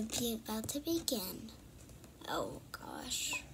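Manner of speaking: scared whisper. Acted. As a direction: hush it in fear. Creepy moment, scared hush.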